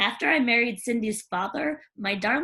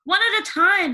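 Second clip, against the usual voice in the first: high, loud call for order; valet voice; 8 semitones higher, 7 dB louder than usual. Acted. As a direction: settle the girls down.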